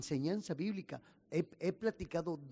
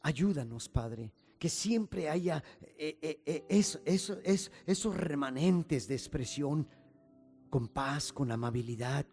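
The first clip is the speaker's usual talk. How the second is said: louder, rising pastor's prayer building in force; fervent prayer; 5 dB louder than usual. Acted. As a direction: pray aloud to the Father with growing fervour. Pastor leads closing prayer.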